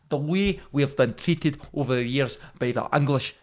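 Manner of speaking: loud mock-outraged Scottish rant; angry Scottish accent. Mock podcast host railing at the English, angry Scottish accent. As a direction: play the aggrieved Scot, loudly. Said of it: "Probably get more angry people".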